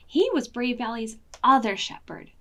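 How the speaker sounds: ordinary delivery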